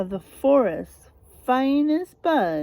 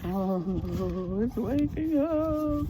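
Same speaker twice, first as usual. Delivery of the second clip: scared hush; 5 dB quieter, much slower than usual. Acted. Teacher replays the animals' line softly.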